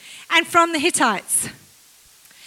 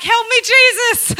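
high, slow pleading plea; desperate prayer; 5 semitones higher, 5 dB louder than usual. Retold or imitated. Preacher mock-praying before Sunday, pleading high and slow. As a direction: beg Jesus for help in mock panic. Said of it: "I'm like".

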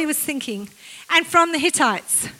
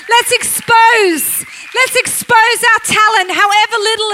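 rallying call; 4 semitones higher, 6 dB louder than usual. Real, not acted. Preacher rallying church.

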